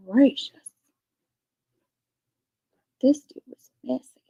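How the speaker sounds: hushed gossip whisper; whispered aside. Real, not acted. Streamer reacting privately to gossip about a scammer, whispering. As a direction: murmur it like a secret to yourself.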